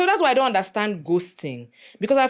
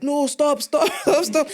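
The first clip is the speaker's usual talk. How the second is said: higher, louder urgent stop, stop; father pleading stop; 9 semitones higher, 3 dB louder, slower than usual. Retold or imitated. Father restrains his furious daughter on the roadside, urgent higher pleading. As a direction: beg her to stop, rising panic. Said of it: "My father is like".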